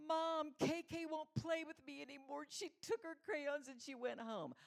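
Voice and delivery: high, loud mock wail of a little girl; high-pitched pretend bawling; imitating a crying child. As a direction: tattle to mom in a put-on tearful whine. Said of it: "she came crying into the front door".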